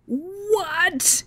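stunned disbelief. Retold or imitated. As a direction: squeal it in disbelief. Hears he will live with his ex.

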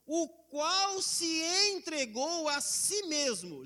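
slow, weighty reading of the verse; reading Galatians aloud, slow and solemn; solemn scripture reading. Acted. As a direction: let each word land slowly, as holy text. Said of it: "quando ele diz no verso quatro de Gálatas um".

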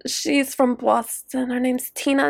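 usual speaking voice

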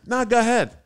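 high, loud mocking dare; hammed-up high taunt; taunting challenge. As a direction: goad him to swing. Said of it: "I go".

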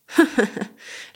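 short breathy scoffing laugh; mocking laugh. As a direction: laugh at the boy. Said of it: "lachte Mexle".

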